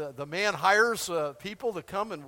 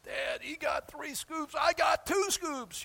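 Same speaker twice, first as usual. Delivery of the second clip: put-on higher, slow whining child; whiny kid; 8 semitones higher, slower than usual. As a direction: whine like a kid cheated of a scoop. Kid complaining at ice cream.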